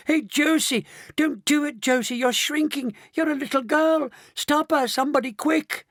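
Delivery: high-pitched panicked shouting. A narrator's reading. Grandpa Joe watching his wife shrink, shouting. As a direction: shout for help, high and frantic. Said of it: "shouted Grandpa Joe".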